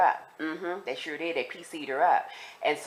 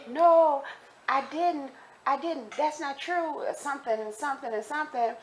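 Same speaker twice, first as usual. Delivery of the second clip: pleading denial; 9 semitones higher than usual. Retold or imitated. Acted denial to accusing women.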